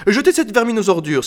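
high, loud command to a servant; princess ordering. A narrator's reading. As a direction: order the valet from across the room. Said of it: "appela la princesse". (ordre lancé d'une voix haute)

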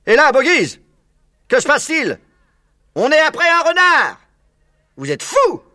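interpellation moqueuse, aiguë et forte; moquerie. A narrator's reading. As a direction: railler les fermiers depuis le bord. Villageois se moquent des fermiers. (les villageois se moquent des fermiers, voix haute et forte)

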